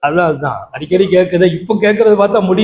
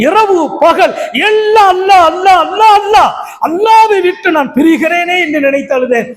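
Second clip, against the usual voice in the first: impassioned preaching; 10 semitones higher than usual. Acted. Prophet explains his weeping for God's remembrance, impassioned preaching. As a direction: build to a fervent, high sermon climax.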